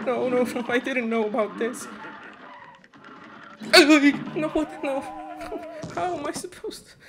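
very high, helpless near-crying protest; distraught whine. Real, not acted. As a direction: squeak it out between laughing and crying. Dismayed at a new phase.

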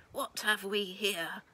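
slow, loud thundering boom from a huge man. Acted. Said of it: "a voice boomed down like thunder".

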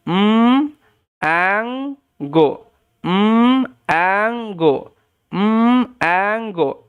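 "উম অ্যাঙ্গো" as held mmm, gap, stretched ango; sounding out. Shown as a demonstration. Teacher leading learners through mango by sounds, slowly sounded out. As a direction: slowly split mango after its hummed first sound. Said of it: "উম, সাউন্ডটা".

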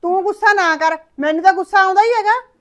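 mother shouting that she is the angry one; angry outburst. Acted. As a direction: let the anger burst out. Mother flares up in quarrel.

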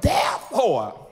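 loud, high preacher's shout, phrase by phrase; full-force preaching declaration. Real, not acted. Preacher leading the church in speaking fearlessness, shouted declaration. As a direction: proclaim each phrase for a congregation to echo.

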